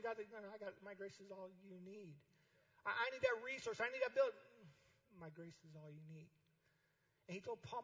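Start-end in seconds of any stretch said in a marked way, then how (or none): 0.3-2.1 God reassuring. Acted. God answering the worried leader. 2.8-4.3 high, loud pleading voice; anxious pleading. Acted. A pastor begging God for resources, high and loud. Voicing the leader's complaints. 5.2-6.3 much softer, calm reply; soft answer. Acted. God replying gently to the pleading pastor, much softer.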